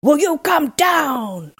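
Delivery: high, slow, drawn-out call; long-drawn storybook holler; cried demand. A narrator's reading. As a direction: stretch out an impatient order. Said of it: "cried Bluebeard".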